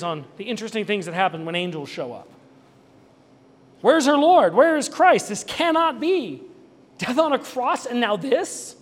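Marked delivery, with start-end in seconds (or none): 3.8-8.7 high, loud outcry of disbelief, drawn out; acted anguish, pitched well up. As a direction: cry out as a bereft follower would. Preacher voices her anguished disbelief.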